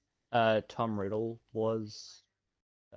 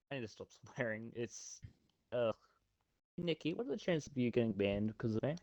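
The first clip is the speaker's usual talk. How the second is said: muttered aside; 8 dB quieter, faster than usual. Real, not acted.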